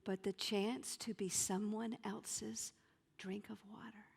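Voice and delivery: usual speaking voice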